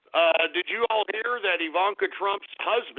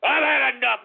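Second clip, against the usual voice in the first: exasperated outburst; 8 semitones higher, 5 dB louder than usual. Real, not acted. Repeat, louder and higher.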